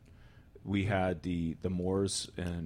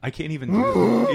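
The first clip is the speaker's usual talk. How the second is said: laughing; 11 semitones higher, 11 dB louder than usual. Real, not acted. Laughing at failed howl attempt.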